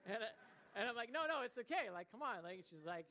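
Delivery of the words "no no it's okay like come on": eager coaxing. Retold or imitated. Coaxing reluctant fiancée into cave.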